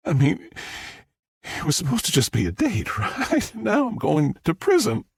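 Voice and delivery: high-pitched, upset protest; distraught, voice climbing as it goes; fighting back tears. A narrator's reading. Star fears prison after the date, fighting tears. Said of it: "She fought back tears through a sniffle".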